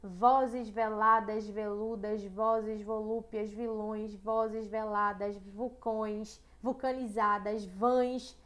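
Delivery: slow reread leaning on every v; stressing the v. Shown as a demonstration. Teacher rereads the poem to highlight the v, slowly. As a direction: let each v buzz in vozes veladas. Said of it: "vejam que há uma repetição do V".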